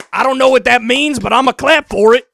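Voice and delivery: loud, dumb mock cheer; mocking the audience that claps for Trump, loud and dim; mock cheering crowd. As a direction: belt it like a clapping fan.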